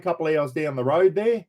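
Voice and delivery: ordinary talk, nothing marked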